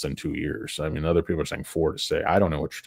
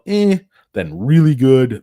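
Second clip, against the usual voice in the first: louder, higher, drawn out; slow excited swell; hyped build; 8 semitones higher, 9 dB louder, slower than usual. Real, not acted. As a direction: sell it like a rising chart. Building hype.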